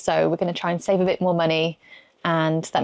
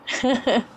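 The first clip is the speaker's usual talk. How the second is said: laugh; 5 semitones higher than usual. Real, not acted. Wife laughs at divorce joke.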